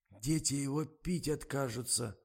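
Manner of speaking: snuffly cameraman's remark; stuffed-up voice. A narrator's reading. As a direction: chip in through a head cold. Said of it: "вступил в разговор простуженный Миша".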